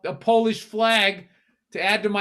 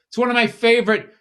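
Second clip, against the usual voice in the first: normal talking voice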